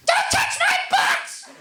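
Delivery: slow, very high, loud screech. Retold or imitated. Old man to stranger offering help, high-voiced shriek. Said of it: "Just high voice like that".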